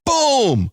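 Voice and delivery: high, punchy mock explosion; boom sound effect. Host acting out the bomb hitting, high mock boom. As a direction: blurt it like a cartoon bomb.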